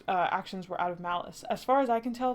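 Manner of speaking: ordinary delivery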